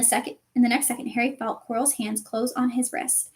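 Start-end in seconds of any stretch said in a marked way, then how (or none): none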